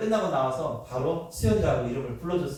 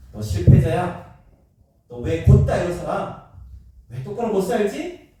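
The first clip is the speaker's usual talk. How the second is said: loud, higher rebuke; scolding shout; 4 semitones higher, 9 dB louder than usual. Pretend. God pictured scolding failing believers, loud shout. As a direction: yell it like a harsh accuser.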